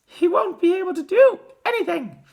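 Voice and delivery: very high, loud cry; distraught mother's wail; cried. A narrator's reading. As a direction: cry it out in despair. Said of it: "cried Missus T V".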